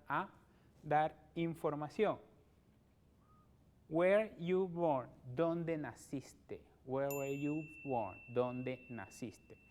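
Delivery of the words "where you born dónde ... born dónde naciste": slow, deliberate question and translation; slow drill. Shown as a demonstration. Teacher drills question and answer slowly for the class. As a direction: pace each word out for repeating. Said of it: "model pairs".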